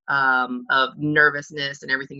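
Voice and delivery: ordinary delivery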